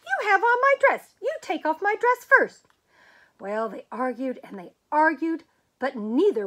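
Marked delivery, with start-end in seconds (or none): 0.0-2.7 high, loud shrill comeback; indignant retort. Acted. Teacher arguing with principal, outraged. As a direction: fire it right back at him. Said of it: "And the teacher said".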